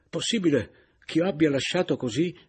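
loud, high, troubled question; sudden dismay. Acted. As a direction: exclaim in startled disbelief. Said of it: "Notando il mio improvviso turbamento". (turbamento improvviso, a voce alta)

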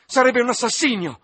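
high desperate shout. Acted. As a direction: cry out in horror. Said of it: "gridò".

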